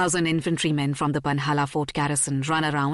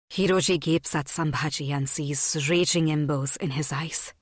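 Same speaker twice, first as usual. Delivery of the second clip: ordinary delivery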